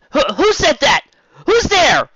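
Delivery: high, loud, startled shout; startled cry. Acted. Startled Rainbow shouts at a hidden voice, high and loud. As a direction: jump at a voice from nowhere. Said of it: "Rainbow quite literally jumped at the voice".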